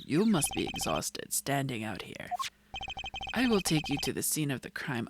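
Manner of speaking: low, smooth ladykiller voice; self-assured, teasing low voice; suave flirt. Acted. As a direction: purr it like a vain charmer. Voices Gavin in the game.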